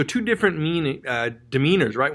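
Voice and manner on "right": ordinary talk, nothing marked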